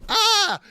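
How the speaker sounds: high, loud mock scream; the virus screaming as it runs away. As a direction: shriek as the cartoon virus flees. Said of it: "then they go".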